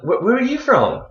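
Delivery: put-on high, surprised girl's question; high quoted question. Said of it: "straight away she was like".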